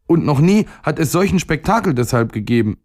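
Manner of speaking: put-on very high, upset little-boy voice; high, aggrieved near-tearful protest; close to tears. A narrator's reading. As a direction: wail the complaint as a boy about to cry. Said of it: "im Begriff, in Tränen auszubrechen".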